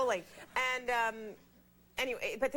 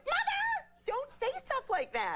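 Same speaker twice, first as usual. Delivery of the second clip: high, slow appalled protest to her mother; shocked protest; much slower than usual. Retold or imitated. Daughter protests to her mother, high and appalled. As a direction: squeal it out, scandalized. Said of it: "I'm like".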